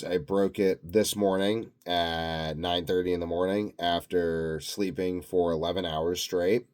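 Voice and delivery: ordinary talk, nothing marked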